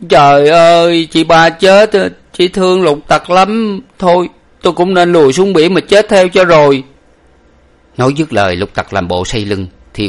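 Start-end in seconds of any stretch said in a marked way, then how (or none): 0.1-6.8 high, loud servant's wail; blubbering lament. A narrator's reading. Servant learning his lady drowned, wailing tearfully. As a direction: wail it out, drawing the words long. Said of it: "mếu máo mà rằng".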